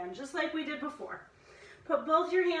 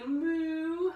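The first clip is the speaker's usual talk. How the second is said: long moo, pitched up; drawn-out, higher cow call; 4 semitones higher than usual. Shown as a demonstration. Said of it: "Cow pose".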